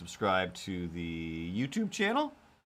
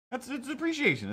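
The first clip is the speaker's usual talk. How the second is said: high cat voice; 13 semitones higher than usual. Pretend. Cat voice continues.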